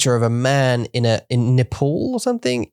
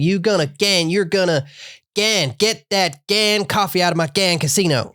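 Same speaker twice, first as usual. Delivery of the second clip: slow, higher, laughing Missouri swearing; Missouri accent; 8 semitones higher than usual. Retold or imitated. Imitating Ruth swearing for a friend, in a laughing Missouri accent. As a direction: ham up the Ozark woman's swearing. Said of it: "I'm saying it in that accent".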